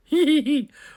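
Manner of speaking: loud high burst of laughter; delighted laugh. Real, not acted. Laughs at boss.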